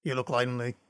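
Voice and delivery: low, softened put-on action-star voice; Jason Statham. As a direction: do a low movie tough guy. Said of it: "a bit of my Statham".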